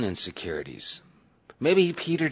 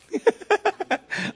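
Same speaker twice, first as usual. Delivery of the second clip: high-pitched teasing laugh; high, amused burst of laughter. Real, not acted. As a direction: laugh while teasing someone.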